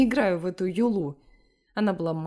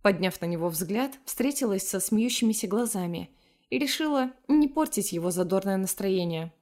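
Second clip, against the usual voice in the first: ordinary delivery, nothing marked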